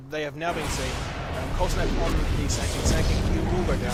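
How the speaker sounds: loud, fast-building casting call of the fight; hyped live esports commentary; excited play-by-play. Acted. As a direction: ride the action as it unfolds, loud.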